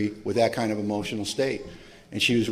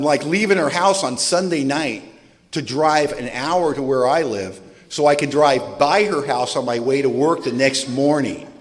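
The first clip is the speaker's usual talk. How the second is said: normal talking voice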